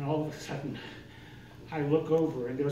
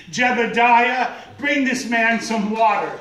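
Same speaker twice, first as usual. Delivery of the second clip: loud, higher, slow call across the yard; calling Jebediah; 5 semitones higher, 9 dB louder than usual. Retold or imitated. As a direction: call the son from afar. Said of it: "This woman says".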